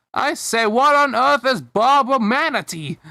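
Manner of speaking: high, loud put-on British voice; British boy's indignant, high-pitched question. A narrator's reading. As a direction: exclaim it in a high British accent. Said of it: "came a high-pitched British voice".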